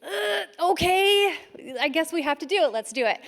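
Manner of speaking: high, hesitant mock agreement; a hint of nervous going along; reluctant okay. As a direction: play along with a wary okay. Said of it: "And I was like".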